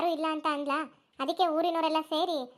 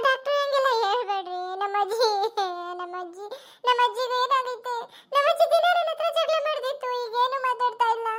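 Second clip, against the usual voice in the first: wailing grief; 7 semitones higher than usual. Acted. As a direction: beg the doctor through tears. Granddaughter wails over dead grandmother.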